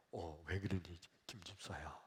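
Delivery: low, soft acted voice; reply calmly to an agitated believer; Jesus answering gently. (낮고 조용하게 달래듯)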